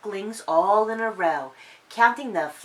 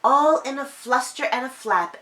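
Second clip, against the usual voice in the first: usual speaking voice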